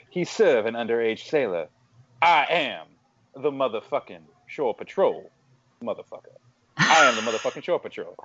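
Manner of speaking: celebrity impression, deliberate dramatic monologue with long pauses; Kevin Spacey. Shown as a demonstration. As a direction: deliver a movie quote as a celebrity impression, measured and pausing. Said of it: "Kevin Spacey as, uh, from House of Cards, uh, doing Jack Nicholson quotes".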